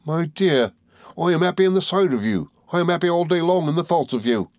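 high-pitched earnest sweetheart; fond country lover, pitched high; devoted Ham. A narrator's reading.

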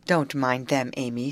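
usual speaking voice

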